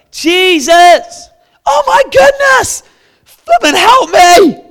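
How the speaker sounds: high, loud desperate yell; desperate shout. Retold or imitated. Beggar screaming over the crowd to Jesus, desperate. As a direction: scream for help at the top of the voice. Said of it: "he started shouting out".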